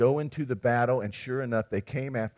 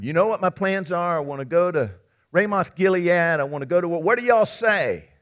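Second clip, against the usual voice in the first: eager king; 6 semitones higher, 6 dB louder than usual. Acted. Ahab eagerly pitches his war plan.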